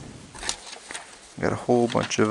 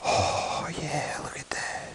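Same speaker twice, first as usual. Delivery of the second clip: soft gasp, hushed and higher; awed whisper; 4 semitones higher, 9 dB quieter, slower than usual. Real, not acted. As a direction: marvel at it in a whisper. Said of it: "I'm talking a little quiet".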